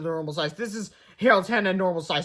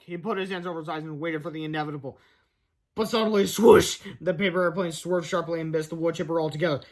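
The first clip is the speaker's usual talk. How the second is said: usual speaking voice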